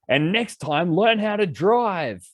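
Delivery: high, slow, sarcastic final jab; parting shot. Retold or imitated. Telling the cab driver off, high drawn-out jab. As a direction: deliver the last line before slamming the door. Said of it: "being like".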